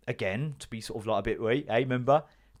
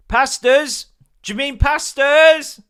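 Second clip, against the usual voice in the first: much higher and louder, slowing on pastas; incredulous shriek; 12 semitones higher, 12 dB louder, slower than usual. Retold or imitated. To Bill about the ingredients, a high incredulous shriek. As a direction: pounce on his mistake.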